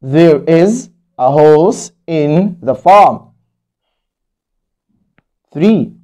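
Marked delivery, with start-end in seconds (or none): 0.0-3.3 each word of the sentence slowly spelled out; slow model reading. Shown as a demonstration. Teacher reads the finished sentence slowly to pupils. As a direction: read it slowly for the class to copy. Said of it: "teacher reads model sentence".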